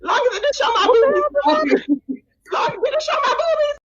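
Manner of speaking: very high chipmunk voice, shrieking with laughter; squeaky sped-up clip voice. Pretend. Host replays her old voice-changer bit, squeaky and shrieking. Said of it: "one more time, y'all".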